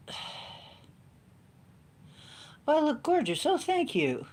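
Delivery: high, preening mock voice; breathy-free high put-on voice, preening; mock vain lady. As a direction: act the flattered diva, lightly. Mock vain compliment reply.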